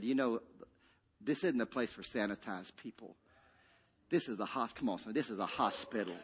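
a lightly voiced kind father; gentle Lord's voice. The Lord speaking gently to him.

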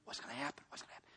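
hushed, very soft mock whisper; imitating servants' whispering. Servants whispering among themselves as the baby is dying, hushed. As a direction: whisper it like servants trading secrets. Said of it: "they were whispering among themselves".

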